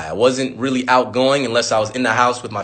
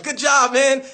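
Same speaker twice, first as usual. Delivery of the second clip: cheering classmates; 10 semitones higher, slower than usual. Retold or imitated. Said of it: "everyone's clapping like".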